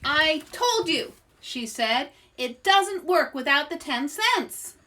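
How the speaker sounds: loud cross fortune teller complaint. Acted. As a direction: stamp your foot and scold. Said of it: "stomped her foot".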